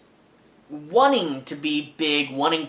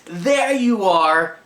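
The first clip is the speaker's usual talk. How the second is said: loud, slow, higher pointing yell; a big hammed-up shout of discovery; triumphant shout; 5 semitones higher, 6 dB louder, much slower than usual. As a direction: bellow it out like you've caught her. Points at secretary from desk.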